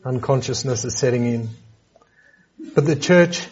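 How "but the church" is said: the word "consciously" drawn out and stressed, called back and forth with the congregation. Shown as a demonstration. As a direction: put the stress firmly on "consciously" and draw it out. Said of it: "I'm emphasising the word consciously".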